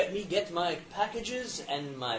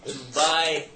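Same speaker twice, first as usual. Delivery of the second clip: usual speaking voice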